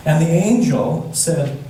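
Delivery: plain reading voice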